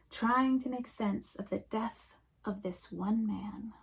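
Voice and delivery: ordinary talk, nothing marked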